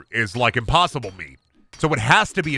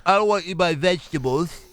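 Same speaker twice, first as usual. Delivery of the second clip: mock anti-soy guy; 5 semitones higher than usual. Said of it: "That's like".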